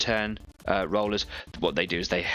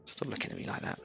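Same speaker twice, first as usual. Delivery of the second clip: hushed muttered scolding; muttered aside; 5 semitones higher, 10 dB quieter than usual. Real, not acted. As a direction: mutter it at the cat. Telling off the staring cat.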